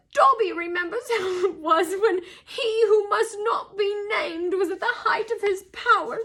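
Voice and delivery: high, earnest elf voice; tearful house-elf. A narrator's reading. Elf recalling how house-elves were treated, tearfully. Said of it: "he admitted, drying his face".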